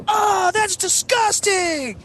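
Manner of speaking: put-on very high, drawn-out girl's squeal; imitated disgusted shriek. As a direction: squeal in mock revulsion, slowly. Imitated girl reacting to vomit.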